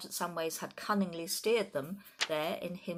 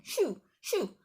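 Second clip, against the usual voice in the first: much higher, loud shooing shouts; shouting; 4 semitones higher, 6 dB louder than usual. A narrator's reading. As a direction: chase the birds off with your voice.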